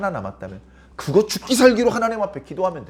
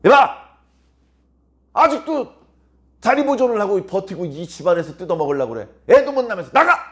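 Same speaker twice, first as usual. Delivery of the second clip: taunting rival; 3 dB louder than usual. Acted. Rival wife bullying Hannah behind husband's back, loud harsh shouting. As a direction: bark the taunts at the childless woman. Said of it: "남편 몰래 한나를 괴롭힙니다".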